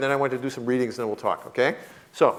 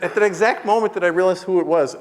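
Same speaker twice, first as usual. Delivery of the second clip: ordinary delivery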